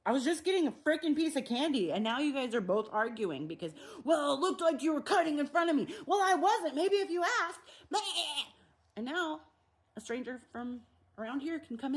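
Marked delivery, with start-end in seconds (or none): 0.1-1.9 higher, louder defensive snap; annoyed retort. Pretend. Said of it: "they're like". 4.0-8.5 high, loud bickering; hammed-up squabble; both sides of a petty line argument; mock argument. Acted argument, two sides.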